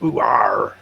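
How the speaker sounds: West Country ooh arr, drawn out; lightly voiced country farmer; farmer accent. Shown as a demonstration. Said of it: "in the U K, it's like".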